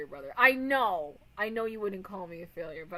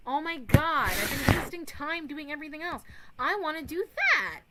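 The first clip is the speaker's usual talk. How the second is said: excited gush; 7 semitones higher, 7 dB louder than usual. Real, not acted. Excited about plan.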